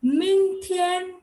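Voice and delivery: Ming lifted high and held level before Tian; Mandarin model word. Shown as a demonstration. Teacher showing students how to say Ming Tian, high tone. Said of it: "how do you say tomorrow".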